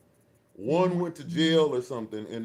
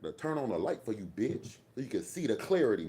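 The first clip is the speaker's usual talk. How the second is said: ordinary talk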